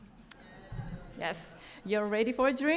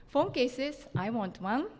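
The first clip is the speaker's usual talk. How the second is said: ordinary talk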